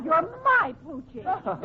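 slow, with "my" stretched out; stress on my. Acted. Wife insisting to her husband, stress on "my". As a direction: draw out "my", insisting, then fall on "Poochie".

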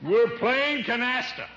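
high, slow shouted retort; exasperated sarcasm. Acted. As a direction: holler up as if he asked something idiotic. Shouts sarcastic answer up cliff.

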